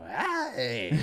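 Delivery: loud, high attention-grabbing "Hey!"; retold scream. Comic screams into a dead mic, retold scream. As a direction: holler it out into the mic. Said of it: "I just scream".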